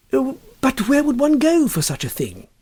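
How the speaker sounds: high, fussy plea; a prim man at a loss; flustered question. A narrator's reading. Huddle asking his friend, high and fussy.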